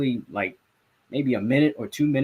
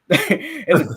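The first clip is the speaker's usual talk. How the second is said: laughing it out, louder; 13 semitones higher, 4 dB louder than usual. Real, not acted. Reporter laughing while describing the silent press room. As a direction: say it through a laugh.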